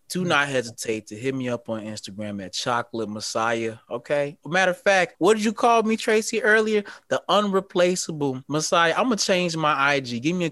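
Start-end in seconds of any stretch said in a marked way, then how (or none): 4.4-10.2 higher, louder, playful bragging; hyped-up self-praise to the host; excited boast. Real, not acted. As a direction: crow about the new nickname. Boasting about his new title.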